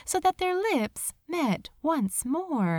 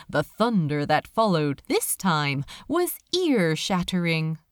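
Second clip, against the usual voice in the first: usual speaking voice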